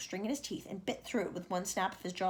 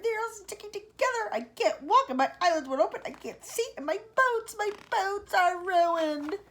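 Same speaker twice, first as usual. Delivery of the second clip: high, loud, whining lament; centipede wailing; 11 semitones higher, 6 dB louder than usual. Acted. Centipede wailing to friends about his painted legs. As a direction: moan like a ruined drama queen. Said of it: "he cried".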